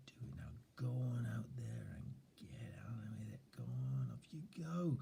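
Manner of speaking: whispered, gentle cheering on; soft encouraging whisper. Shown as a demonstration. Encouraging a shy six-year-old self in whispers. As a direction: cheer a shy child on in a hushed voice. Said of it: "soften your voice".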